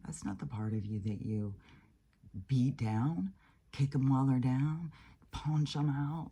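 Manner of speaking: ordinary talk, nothing marked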